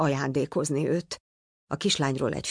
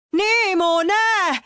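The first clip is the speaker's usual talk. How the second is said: even higher, louder, stretched-out cry; desperate shout; 5 dB louder, much slower than usual. A narrator's reading. Father screams his son's name even higher as the boat vanishes. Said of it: "kiabált Pizsi".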